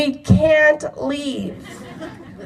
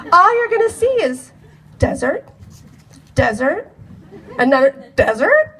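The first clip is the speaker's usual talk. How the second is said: laughing; 3 semitones higher than usual. Real, not acted. Laughing at the desert view.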